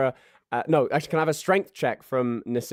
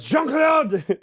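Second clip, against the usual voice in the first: high, loud call across the kitchen; yelling a name; 13 semitones higher, 6 dB louder than usual. Acted. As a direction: shout for Jean-Claude from across the room. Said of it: "he yells over".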